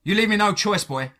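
high, emphatic exclamation; exclaiming. A narrator's reading. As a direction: declare it forcefully as a last warning. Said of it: "he exclaimed".

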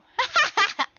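high burst of laughter. Real, not acted. Streamer amused by own mimicry, high real laugh. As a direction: crack up in a high-pitched laugh.

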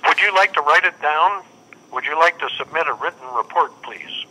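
normal talking voice

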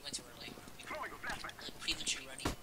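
whispering. Real, not acted. Muttered aside.

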